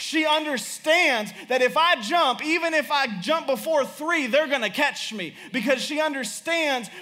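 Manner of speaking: high-pitched, driving sermon delivery; impassioned preaching. Real, not acted. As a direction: preach it urgently, pressing each point home.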